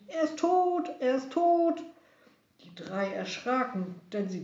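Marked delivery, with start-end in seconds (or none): 0.1-1.8 much higher, louder jubilant shout; triumphant shout. Acted. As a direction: cry out the news as you wave flags. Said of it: "Sie schwenkten kleine Fahnen und riefen".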